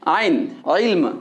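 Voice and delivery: आइन and इल्म said low, starting deep in the throat; demonstrating Urdu ain to students; throaty ain. Shown as a demonstration. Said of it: "ये हम हलक से निकाल रहे हैं".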